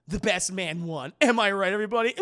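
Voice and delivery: loud, crowing mock boast; not humble. Eddie brags to the staff after winning, crowing. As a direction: gloat loudly to the whole room. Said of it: "Just, like, not humble at all".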